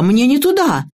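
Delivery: high-pitched protest; mock yell of alarm. A narrator's reading. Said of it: "завопила я". (высокий протестующий возглас)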